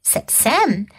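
ordinary delivery, nothing marked

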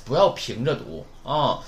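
ordinary delivery

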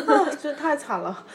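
laughing at her own misery; a real rueful laugh over the words; laughing wryly at how sad it was. Real, not acted. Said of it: "真的好惨".

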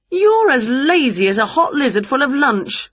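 high-voiced scolding of a lazy pet; exasperated scolding. Winnie scolds her lazy cat.

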